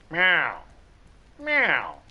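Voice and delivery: high, loud meow; cat meowing at the bedroom door. Retold or imitated.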